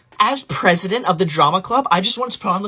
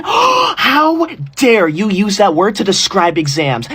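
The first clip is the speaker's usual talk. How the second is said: gasp, then slow, drawn-out indignation; scandalized outrage; 3 dB louder, slower than usual. Acted. Outraged that exams are called stupid.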